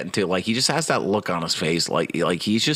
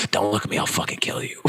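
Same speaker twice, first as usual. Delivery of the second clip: softer menacing hiss; Baldwin whisper; 3 semitones lower, 3 dB quieter than usual. Retold or imitated. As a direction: threaten under your breath. Continuing Baldwin impression.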